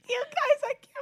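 shrieking with laughter; high laughing cry out. Real, not acted. Laughing shout to audience.

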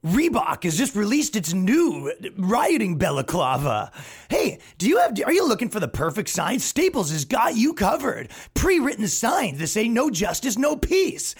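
high, slow hyped commercial voice; mock ad. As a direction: sell it like a cheesy TV spot. Fake advertisement parody.

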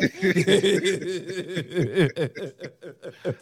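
extended laughter in a trailing run; long real laugh. Real, not acted. Co-host cracking up at his own joke, long laugh.

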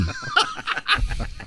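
airy giggling laugh; high breathy laugh. Real, not acted. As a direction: burst out giggling. Laugh after joke.

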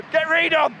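loud, high demand at the pitch; shouting. Real, not acted. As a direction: yell it at the manager. Shouting at the match.

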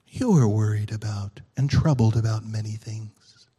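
low, slow and hushed, a loving rebuke; gentle Jesus voice. Acted. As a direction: soften right down, as if calming a fretful friend. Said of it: "I think he kinda talks like this".

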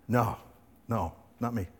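low, hushed no; nervous denial. Acted. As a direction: try to shrink from notice. Peter's first denial.

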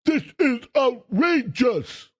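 high, slow, drawn-out mock outrage; mocking whiny Republicans. Host mocking Republicans complaining about the prosecution, hammed-up whine. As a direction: ham up their fake indignation, stretching outrageous. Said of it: "all Republicans say".

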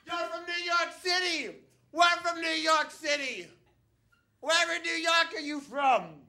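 put-on high, shrieking party girl; loud drunk New Yorker. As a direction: yell it high like an overexcited drunk tourist. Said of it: "they are so loud".

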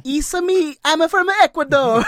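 high, loud mock Italian accent; Spanish accent slipping into cartoon Italian; hammed-up Italian, like a video-game plumber; Mario-style Italian accent. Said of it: "they all turn into, like, Italian".

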